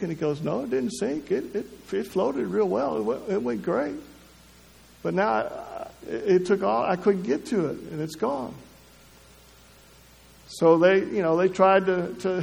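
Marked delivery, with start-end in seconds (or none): 0.4-8.5 put-on upset little boy. Boy voiced telling parents of loss.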